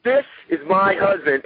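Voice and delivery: high, emphatic "This is my husband"; wife proudly announcing. Retold or imitated. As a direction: announce it to the whole store. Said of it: "She'd get so mad".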